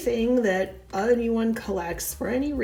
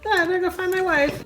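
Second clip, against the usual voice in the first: hurried brother-in-law; 7 semitones higher, much faster than usual. Acted. Brother-in-law escaping skit.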